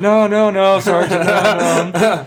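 a scared private backpedalling fast; panicked denial. Retold or imitated. Private protests to his sergeant, panicked high pleading. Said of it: "And he's like".

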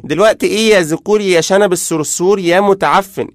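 high, loud tirade of insults; acted furious yelling; angry outburst. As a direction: explode at him in outrage. Girl explodes with insults at him.

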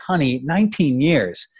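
high-pitched, agitated father's protest; worked-up dad, shaken by the numbers; heated. A narrator's reading. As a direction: argue with rising heat, pitch up. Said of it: "filled him with a strange, heart-shaking heat".

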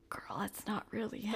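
hushed, breathy disbelief; whispering. Retold or imitated. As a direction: whisper it like a secret. Friends doubting the fake boyfriend.

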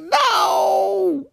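very high wailing no; mock horror. Told she did it again, high mock wail. Disbelief at niece.

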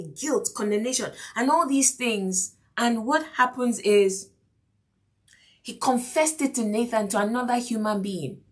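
normal talking voice

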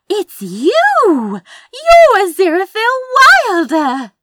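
very high, loud, drawn-out fan shriek; star-struck squeal. A narrator's reading. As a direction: squeal in ecstatic recognition. Fan recognises her favourite author.

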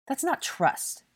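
high-pitched angry contradiction; indignant objection. A narrator's reading. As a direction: object in outrage. Blaise objects in quarrel.

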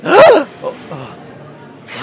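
sudden high frightened yelp; startled yelp. Acted. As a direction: jump at the scare and cry out. Acted fright from drawn gun.

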